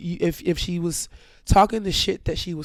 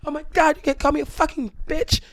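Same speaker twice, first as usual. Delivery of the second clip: high-pitched mock outrage; screechy retold fury; mad; 12 semitones higher, faster than usual. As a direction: imitate her indignant tirade, pitched way up. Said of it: "And she got mad at me".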